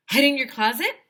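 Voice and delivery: high, loud hopeful guess; kid shouting. A narrator's reading. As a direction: shout out the answer. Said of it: "I shouted".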